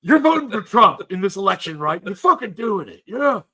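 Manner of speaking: loud American wrestler promo; Hulk Hogan impression. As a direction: cut a wrestling promo, shouting. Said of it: "Thanks, then, Hulk Hogan".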